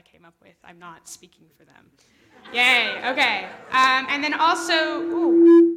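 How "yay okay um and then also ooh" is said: loud, startled exclamations; a burst of loud surprise. Real, not acted. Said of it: "that is loud".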